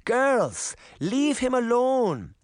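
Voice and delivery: high, drawn-out granny voice; slow, high-pitched telling-off; scolding granny. A narrator's reading. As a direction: call the children off in a high voice.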